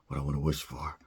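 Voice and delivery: hushed secret wish, breathed with no voice; whisper. Retold or imitated. Geppetto wishing on the star, whispered.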